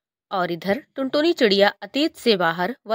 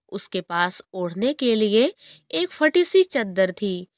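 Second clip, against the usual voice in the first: ordinary delivery, nothing marked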